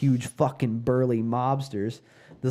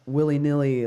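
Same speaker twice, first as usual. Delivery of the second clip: usual speaking voice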